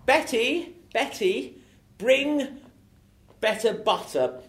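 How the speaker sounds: high, loud improv character. Acted. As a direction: play it big and high. Improv scene, host plays character.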